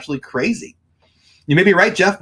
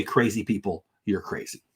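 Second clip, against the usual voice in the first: low muttered aside; 5 semitones lower, 8 dB quieter than usual. Shown as a demonstration. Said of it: "I'll start".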